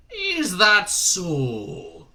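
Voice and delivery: very slow, loud gruff drawl; a suspicious old sergeant; Shadwell's accent. Acted. Shadwell doubting Newton's uncle. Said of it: "said Shadwell".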